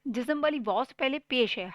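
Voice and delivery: ordinary delivery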